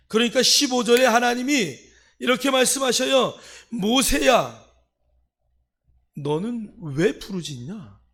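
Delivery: loud, high call that slows into a challenge; forceful preaching. Real, not acted. Preacher voicing God challenging Moses, forceful preaching. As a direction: cry out the name, then press the question.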